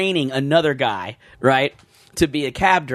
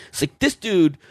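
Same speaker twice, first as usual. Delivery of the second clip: normal talking voice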